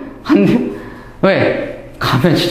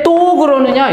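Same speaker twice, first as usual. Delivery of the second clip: mock angry retort; 7 semitones higher, slower than usual. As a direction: snap it back as the mob would. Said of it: "이러는 거예요".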